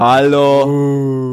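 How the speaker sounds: long, loud, sung-out hello; drawn-out greeting. Acted. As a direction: call it out long to the listeners. Das Hallo war, war für euch da draußen. (langgezogenes, lautes Hallo an die Hörer da draußen)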